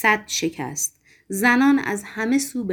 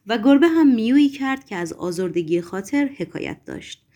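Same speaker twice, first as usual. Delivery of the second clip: usual speaking voice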